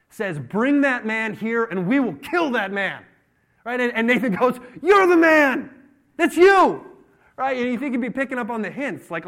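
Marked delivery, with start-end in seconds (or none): 0.4-3.0 loud, high, slow royal command; outraged decree. Acted. King David sentencing the man in the parable, loud outrage. David passes angry judgement. 4.8-6.9 full-force high shout; shouted accusation. Acted. Nathan confronting David with his guilt, shouted accusation.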